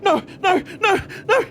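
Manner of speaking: high, loud frantic cries; terrified goblin yelling; panicked screaming. Acted. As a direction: scream as your sleeve catches fire. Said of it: "And he's, like, screaming".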